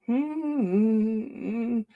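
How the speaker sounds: long playful hum; attempted impression of a cute hum; imitated hum. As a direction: try to copy someone's little hum. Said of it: "hum that pedal does".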